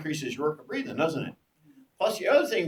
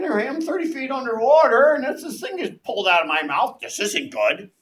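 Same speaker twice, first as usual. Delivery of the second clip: panicked thought; 9 semitones higher, 8 dB louder than usual. Retold or imitated. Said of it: "You're thinking".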